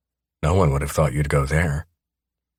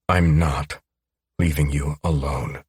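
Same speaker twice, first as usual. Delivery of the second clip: firm, no argument. A narrator's reading. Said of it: "My tone brooks no argument".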